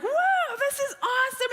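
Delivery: high, thrilled exclamation; excited whoa. Retold or imitated. Pastor voicing joyful surprise, excited. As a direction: gasp it out with glee.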